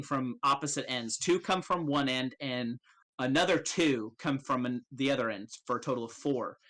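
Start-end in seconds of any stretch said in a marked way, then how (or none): none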